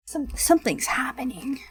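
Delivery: whispered, uneasy aside; hushed suspicion. Real, not acted. Streamer whispers that something is off in the story, hushed.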